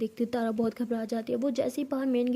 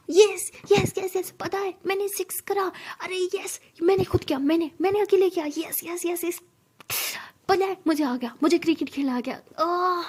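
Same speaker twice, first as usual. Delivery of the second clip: overjoyed cheering; 5 semitones higher than usual. Acted. Sara running to Tara, thrilled about her six. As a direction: cheer like you just hit your first six.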